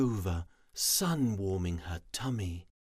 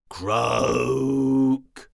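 loud frog croak; 5 semitones higher, 9 dB louder than usual. Acted. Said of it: "and croaks".

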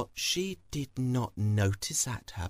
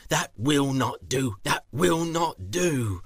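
loud, emphatic refusal, slowing; stern farmer; 5 dB louder than usual. Acted. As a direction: reject it firmly, dragging the repeat. Farmer rejects excuse emphatically.

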